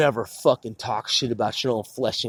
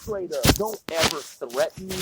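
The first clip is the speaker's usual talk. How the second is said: ordinary talk, nothing marked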